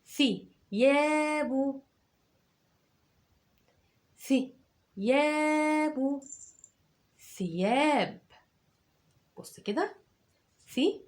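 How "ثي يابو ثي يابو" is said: ثي، يابو drawn out at a crawl, higher; teacher spelling ثيابو for pupils; slow dictation. Shown as a demonstration. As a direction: stretch يابو slowly for dictation. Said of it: "اكتب كده معايا".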